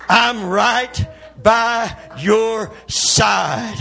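loud, very slow, each word stretched out; drawn-out preaching shout. Acted. Preacher repeating God's promise to the congregation, drawn-out shout. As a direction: shout it one long word at a time.

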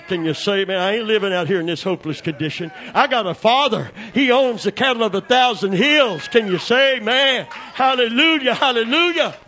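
high, loud shouted preaching; climbing sermon shout; preaching at full force. Acted. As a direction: whoop it up like a revival preacher.

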